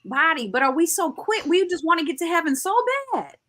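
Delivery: high-pitched preacher's exhortation; impassioned preaching. Real, not acted. Urging believers to take care of their temple, preaching.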